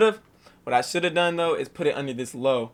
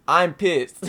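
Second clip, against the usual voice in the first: louder, half-joking angry "I'm pissed"; mock outburst, louder; mock anger; 3 semitones higher, 7 dB louder than usual. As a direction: play up being furious, louder. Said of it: "I'm not really mad".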